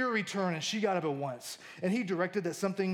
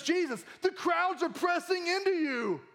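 higher, stating the obvious like a clueless disciple; hammed-up Peter pointing out the obvious; mock clueless Peter; 11 semitones higher than usual. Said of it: "He says, he says".

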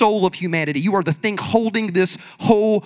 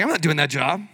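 a quiet, lowered sniff of disdain; snooty refusal; 7 semitones lower, 7 dB quieter than usual. Retold or imitated. Churchgoer turning up their nose at a chore, quiet and snooty. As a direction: say it like the chore is below you. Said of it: "and be like".

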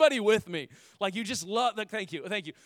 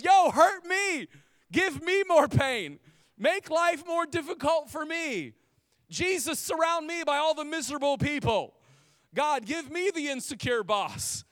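mock pleading; 7 semitones higher, 5 dB louder than usual. Preacher mocking a prayer nobody would pray, loud and sarcastic. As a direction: beg for pain in an over-the-top mock prayer.

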